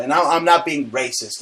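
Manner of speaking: ordinary delivery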